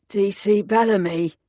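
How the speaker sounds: a light croak from a weak sick man. A narrator's reading. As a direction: rasp it out faintly from the hospital bed. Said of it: "he croaked".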